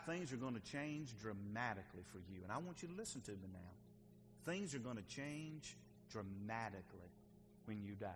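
low, soft and solemn; hushed warning. Real, not acted. Preacher warning the congregation about death, hushed and low. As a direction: drop right down so they lean in to listen.

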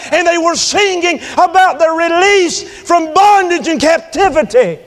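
high, loud, sustained pulpit shouting; impassioned preacher's holler; full-force preaching. Real, not acted. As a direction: exhort the congregation at full voice.

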